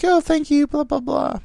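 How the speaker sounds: very high singsong thank-you; high-pitched, perfunctory gushing; mock flattered thanks. Retelling his thanks for a compliment.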